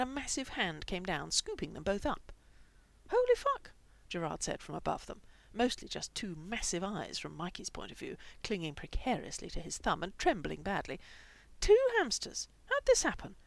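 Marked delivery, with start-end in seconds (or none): 3.1-3.7 very high, loud exclamation; astonished. A narrator's reading. Owner astonished at two hamsters. 11.6-13.4 very high, loud squeal of surprise; delighted astonishment. A narrator's reading. Owner astonished at two hamsters.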